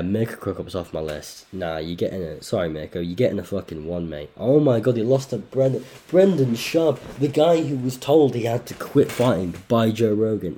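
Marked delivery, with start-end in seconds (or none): 4.4-10.6 high, loud disbelieving outburst; outraged rant. Real, not acted. Ranting to the audience about the loss, loud and high. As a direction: rant at the absurd result.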